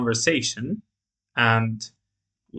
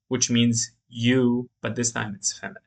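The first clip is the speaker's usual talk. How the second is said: usual speaking voice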